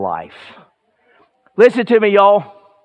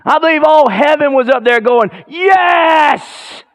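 higher and louder, building to a shouted Yes; full-force preaching; 12 semitones higher, 6 dB louder than usual. Acted. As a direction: preach it out with fire to the congregation.

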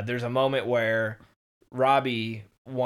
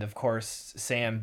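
ordinary talk

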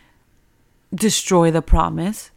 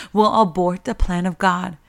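ordinary delivery

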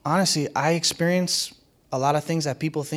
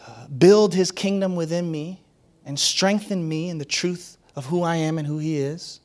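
normal talking voice

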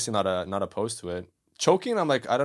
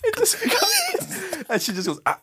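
high-pitched laughing retelling; 7 dB louder than usual. Real, not acted. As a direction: tell it while cracking up. Laughing while retelling slap.

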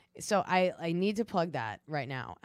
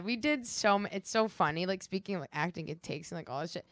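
ordinary delivery

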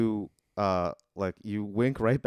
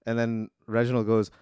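ordinary delivery

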